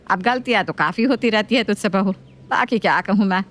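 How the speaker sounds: nagging mother-in-law voice. Acted.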